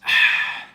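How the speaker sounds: long sigh of relief; relieved sigh. Real, not acted. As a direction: let out a tired, relieved breath. Sigh right after finishing run.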